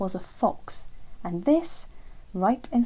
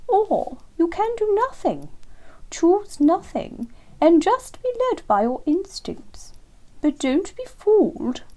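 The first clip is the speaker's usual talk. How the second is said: slow, high, lofty lecturing owl voice; superior wise owl; 10 semitones higher, 6 dB louder, slower than usual. Acted. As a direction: explain like a grand old teacher, slowly.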